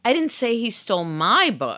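"my" punched; "my" stressed, rest even. Shown as a demonstration. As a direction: lean on "my". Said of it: "stress the subsequent word".

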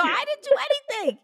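high, loud protesting squeal; loud high mock denial. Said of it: "I go".

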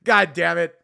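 loud frustrated swearing; frustrated curse. Retold or imitated. Comedian swearing at himself after weak applause, loud. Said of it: "I was like".

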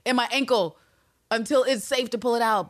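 high-pitched, hurried scheming; urgent inner monologue. Acted. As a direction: think aloud in a high, urgent voice. Imagined anxious inner plan, voiced.

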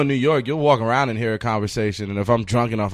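ordinary talk, nothing marked

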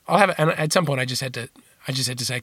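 ordinary talk, nothing marked

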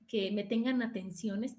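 ordinary talk, nothing marked

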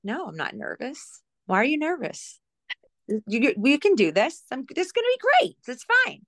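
rising, bubbly, confident; peppy retold pep talk; upbeat reassurance. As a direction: brush off the worry cheerfully. Retold reassuring a worried coworker.

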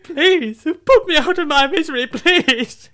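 high, drawn-out melodramatic plea; hammed-up wailing despair; anguished begging. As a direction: beg for mercy like a broken pet. Dog begging to die.

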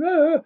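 sharp high yelp of panic, relived; retold panicked cry. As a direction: cry out like a frightened boy waking. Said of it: "waking up saying".

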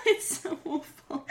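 normal talking voice

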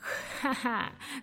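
slow villainous cackle; evil laugh. Acted. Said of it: "a minha risada maléfica".

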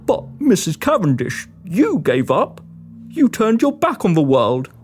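low man's voice; low-pitched, hesitant challenge; questioning monster hunter. Acted. Walton challenges Cornelia.

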